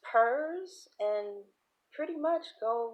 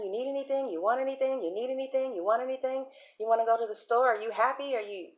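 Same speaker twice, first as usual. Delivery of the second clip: higher, repetitive singsong questions; fussing nurturer; 3 semitones higher, faster than usual. Acted. Aunt fussing over visiting relative, higher insistent mothering. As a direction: hover with one offer straight after another. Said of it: "she's constantly nurturing".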